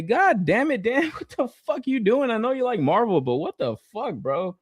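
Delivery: high-pitched mock scolding of a friend; retold incredulous outburst. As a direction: act out tripping over a friend's confession. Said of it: "yeah, I was tripping".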